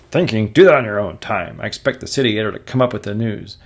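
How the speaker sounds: loud, fast scolding; scolding boss. Acted. Jonah scolding Robbie loudly and fast. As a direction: tear into him for daydreaming.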